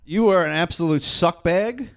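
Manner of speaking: mock insult. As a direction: tell off the toy for fun.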